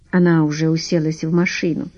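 plain reading voice

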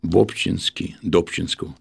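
ordinary talk, nothing marked

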